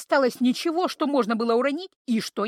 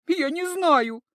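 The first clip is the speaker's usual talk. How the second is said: scared denial; 6 semitones higher, 3 dB louder, much slower than usual. A narrator's reading. Frightened creature.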